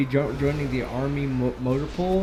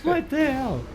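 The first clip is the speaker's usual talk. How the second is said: shocked squeal; slower than usual. Real, not acted. Shocked at the joke.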